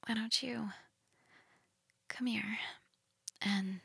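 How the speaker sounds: soft inviting whisper. Acted. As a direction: coax them in a whisper.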